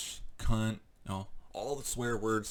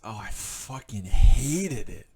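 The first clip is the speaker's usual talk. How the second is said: louder, slow furious outburst; real anger; 3 semitones higher, 11 dB louder, slower than usual. Real, not acted. Vlogger venting about the rude man, furious. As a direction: let the hate spill out, slow and loud.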